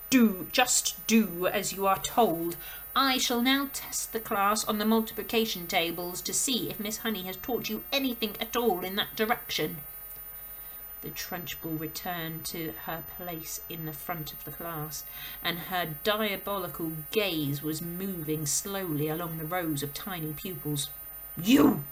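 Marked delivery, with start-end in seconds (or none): 0.1-9.8 stern bossy headmistress. Acted. Headmistress orders Miss Honey about, loud and stern. 21.4-21.8 loud sharp bark. Acted. Headmistress singles out Rupert with a loud bark. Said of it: "she barked".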